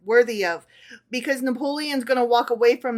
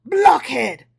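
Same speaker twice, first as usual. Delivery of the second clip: louder, impatient snap at a fool; impatient exclaim; 4 semitones higher, 7 dB louder than usual. Acted. Emperor snaps impatiently at the petty tailor. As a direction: scold him with impatience. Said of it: "exclaimed Napoleon impatiently".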